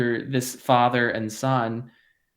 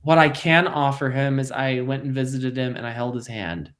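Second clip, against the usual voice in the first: ordinary delivery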